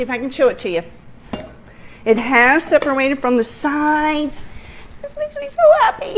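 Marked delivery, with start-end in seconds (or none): 5.0-6.2 high, fast, laughing through the words; happy laughing. Real, not acted. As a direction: giggle with delight. Thrilled at the cake.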